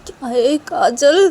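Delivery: put-on very high, loud shrill-pitched girl's voice; shocked disbelief. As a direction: shriek in disbelief. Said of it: "Reshma बोलती कि".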